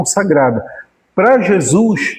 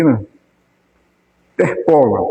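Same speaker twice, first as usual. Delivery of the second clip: normal talking voice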